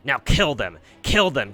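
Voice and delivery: loud, insistent kill command; shouted order. Acted. Fairy ordering the humans killed, loud insistent shouts. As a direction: bark it at the others.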